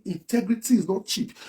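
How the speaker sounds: slow, measured delivery of the slogan; quoted slogan. Retold or imitated. Quoting what "they say" about integrity, slow and measured. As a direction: spell out the slogan slowly and evenly.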